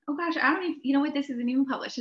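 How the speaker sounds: normal talking voice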